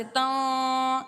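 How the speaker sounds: تاں with a hidden nasal, drawn out slowly; slow nasal ikhfa on تاں. Shown as a demonstration. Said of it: "اس طرح کی آواز آنی چاہیے".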